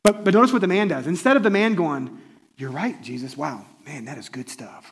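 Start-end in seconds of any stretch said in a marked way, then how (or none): 2.6-4.9 soft, low-key admiring reply; hushed mock awe, trailing away; quietly impressed. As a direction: murmur it as an imagined convert. Imagined admiring reply to Jesus.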